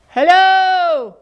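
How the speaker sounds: Little Beaver calling across the pond. Acted. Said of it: "he cried".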